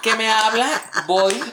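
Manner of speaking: high, loud laughing protest. Real, not acted. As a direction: laugh while denying it.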